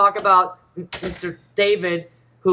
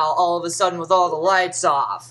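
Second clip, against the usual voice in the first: ordinary delivery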